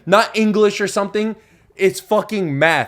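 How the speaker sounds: loud, high boast, slowing down; triumphant gloating. Retold or imitated. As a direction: hammer the victory home loudly. Still gloating about the math score.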